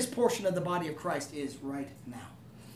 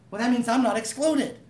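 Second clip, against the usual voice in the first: ordinary talk, nothing marked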